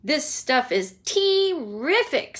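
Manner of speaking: put-on slow, relishing boy's voice; greedy boy. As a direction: savour every word. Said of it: "said Augustus".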